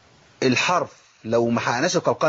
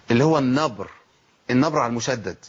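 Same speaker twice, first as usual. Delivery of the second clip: ordinary talk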